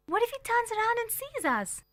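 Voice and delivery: put-on high, anxious whisper-like child's question; scared of being caught; girl fearing the man will see them; worried child. A narrator's reading. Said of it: "I asked".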